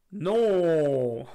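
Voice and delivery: loud, ironic cry of no; mock soft no. Streamer imitating Albus, mock soft then loud. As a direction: shout no, joking it is gentle. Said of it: "d'une voix douce".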